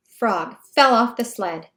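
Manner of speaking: ordinary delivery, nothing marked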